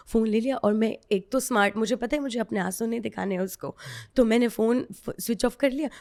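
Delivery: usual speaking voice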